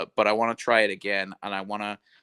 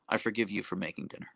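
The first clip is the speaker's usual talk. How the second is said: ordinary delivery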